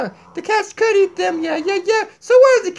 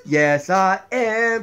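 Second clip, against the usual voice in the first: slow, much lower puppet reply; 10 semitones lower, much slower than usual. Acted. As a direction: answer slowly as the low puppet. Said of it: "really bad ventriloquist".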